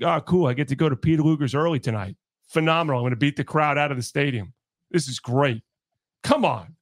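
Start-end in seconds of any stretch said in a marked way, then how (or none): 0.0-5.6 low, fast, breezy mock cheer; mock Boone. Host mocking the manager's ejections, low fast breezy. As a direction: rattle it off like a happy-go-lucky manager, lower. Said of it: "it's always like".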